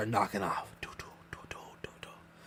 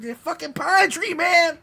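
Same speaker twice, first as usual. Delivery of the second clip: higher, louder angry yell of "The fucking pine tree"; retold drunk yell; 13 semitones higher, 4 dB louder than usual. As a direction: shout it like a drunk blaming the tree. Retelling the drunk outburst.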